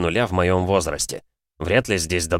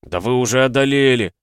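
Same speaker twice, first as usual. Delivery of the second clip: deep-voiced shout; 4 semitones higher, 3 dB louder than usual. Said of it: "басом прикрикнул цыган".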